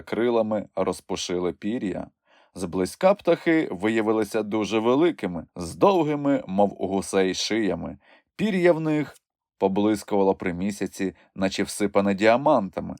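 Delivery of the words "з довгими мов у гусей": plain reading voice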